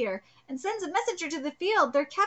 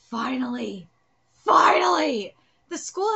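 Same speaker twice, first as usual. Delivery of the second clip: excited shout; 5 dB louder than usual. Acted. Character's excited outburst.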